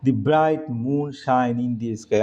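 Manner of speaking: very slow, careful reading; slow dictation. Shown as a demonstration. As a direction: read the sentence slowly for the class. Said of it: "reads sentence slowly". (ধীরে বাক্য পড়া)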